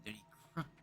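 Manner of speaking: ordinary delivery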